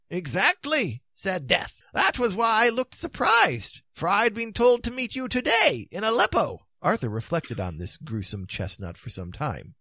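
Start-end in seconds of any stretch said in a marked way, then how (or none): 0.1-6.7 high-pitched, animated wizard storyteller; high, theatrical Merlin voice; Merlin telling a grim tale. Acted. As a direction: spin the tale high and emphatically, as old Merlin. Merlin's tale continues, Death speaking.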